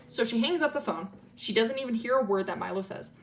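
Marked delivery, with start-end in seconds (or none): none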